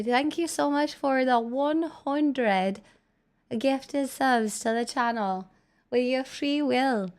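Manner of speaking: put-on slow, lilting Irish accent. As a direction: thank them in a drawn-out Irish brogue. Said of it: "thank you in an Irish accent".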